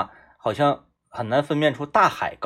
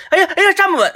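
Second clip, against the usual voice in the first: high, louder mock alarm breaking into laughter; faked wobble panic; 6 dB louder, faster than usual. Fake beginner pretending to wobble, laughing mock alarm. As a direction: fake losing your balance, laughing through it. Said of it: "一定要做的是".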